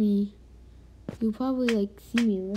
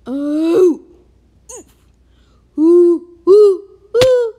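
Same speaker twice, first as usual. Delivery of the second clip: very loud, high squealing; excited yelling; 7 semitones higher, 14 dB louder, slower than usual. Real, not acted.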